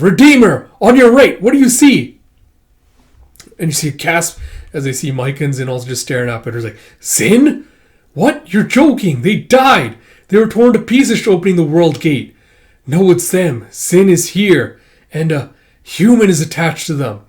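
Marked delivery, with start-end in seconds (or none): 0.0-2.2 raised, high urgent voice; urgent loud call. Acted. Redeemer urging, raised voice. 7.0-17.2 high, loud shocked voice; stunned disbelief. Acted. Shocked character sees dead friend.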